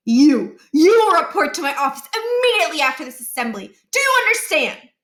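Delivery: loud, commanding principal; stern scolding. A narrator's reading. Principal ordering a student to his office, loud and stern. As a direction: lay down the law.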